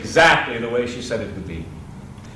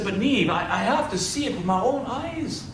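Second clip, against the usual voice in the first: high pleading, rising as it goes; pleading insistence; 7 semitones higher than usual. Acted. Husband begging Niamh to let him see Ireland, pleading. Oisin pleading with his wife.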